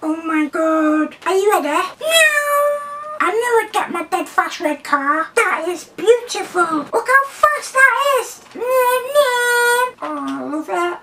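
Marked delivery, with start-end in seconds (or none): 2.0-2.9 high drawn-out toy-car "neeow"; imitated car zoom. Playing with a new toy car, squeaky engine noise. 8.5-9.9 high sing-song toy-car "neeow"; imitated car zooms. Showing how fast the car is, squeaky zooming.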